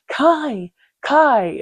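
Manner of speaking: calling out. Retold or imitated. Calling after her as she leaves.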